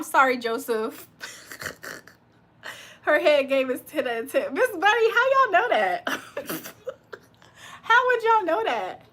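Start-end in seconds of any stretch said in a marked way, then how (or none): 4.5-6.7 laughing disbelief. Real, not acted. Reacting to chat with laughter. 7.8-9.0 high, loud laughing challenge; amused disbelief. Real, not acted. Reading a cheeky comment back to chat, incredulous.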